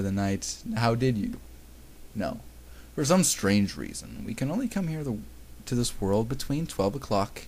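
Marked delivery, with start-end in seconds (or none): none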